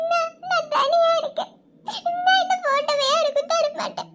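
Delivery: high weeping, pushing them away; crying refusal; tearful refusal. Acted. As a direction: sob stubbornly, begging to be left alone. Sister clinging to photo, crying.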